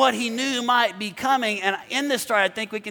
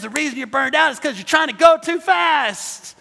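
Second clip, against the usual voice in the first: raised pitch, building to a loud finish; full-force preaching; 5 semitones higher, 5 dB louder than usual. Real, not acted. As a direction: drive the point home loudly.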